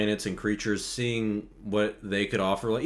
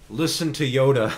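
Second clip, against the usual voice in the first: loud plea at the screen; calling out; 5 semitones higher, 7 dB louder than usual. Real, not acted. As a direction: urge him loudly. Yelling advice at the screen.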